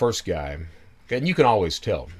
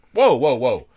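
loud startled whoa; louder surprised stop; 3 semitones higher, 8 dB louder than usual. Retold or imitated. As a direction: pull up short, loudly. Own reaction retold loudly.

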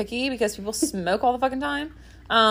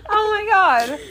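high-pitched, laughing exclamation; real laughter; 10 semitones higher, 4 dB louder, slower than usual. Real, not acted. Reacting to friend's pink eye joke, high and laughing.

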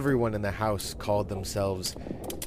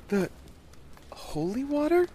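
put-on high child voice rising in question; halting little girl's question; puzzled young girl. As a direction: query it, baffled.

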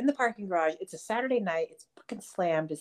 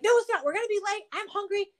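much higher whiny protest; whiny hungry complaint; 13 semitones higher, faster than usual. Retold or imitated. Wife whining to husband about being late, much higher whine. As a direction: whine like a hangry kid. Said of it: "I'm like".

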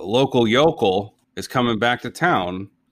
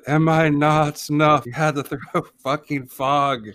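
slow, raised spooky ghost voice; unearthly voice; 5 semitones higher than usual. Acted. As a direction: play a wounded forest spirit. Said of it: "the ghost voice from the Grizzly Maze said".

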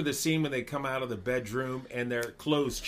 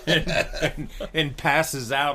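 laughing; 3 semitones higher, 7 dB louder than usual. Real, not acted. Laughing while retelling scene.

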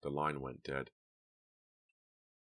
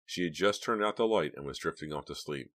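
usual speaking voice

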